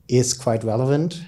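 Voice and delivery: usual speaking voice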